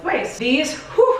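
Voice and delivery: breathless after being sick; a little winded, catching breath. Real, not acted. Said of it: "I'm out of breath".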